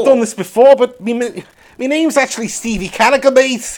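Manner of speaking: hammed-up Scouse lad; badly faked Scouse twang; mock Scouse accent. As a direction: put on a dodgy Liverpool accent. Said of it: "that accent was proper abysmal".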